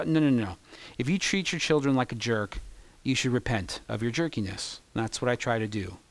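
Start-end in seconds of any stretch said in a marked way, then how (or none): none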